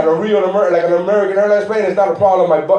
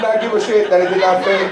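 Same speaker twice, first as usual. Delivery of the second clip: ordinary talk, nothing marked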